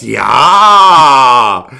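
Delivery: very loud, drawn-out exclamation; hammed-up booming police certainty; mock triumphant officer. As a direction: stretch it out like an officer who has caught someone. Retold officer triumphant about drugs.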